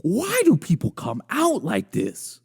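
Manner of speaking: high, slow, drawn-out dismay; appalled disbelief. Real, not acted. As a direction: wail the question, stretching key words. Appalled at woman's outfit in video.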